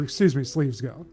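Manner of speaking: normal talking voice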